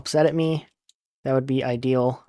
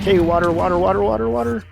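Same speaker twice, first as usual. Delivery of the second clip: rising, higher-pitched frantic repetition; panicked urgency; 5 semitones higher, faster than usual. Real, not acted. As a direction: chant it urgently as the pitch climbs. Player urgently needs water while falling.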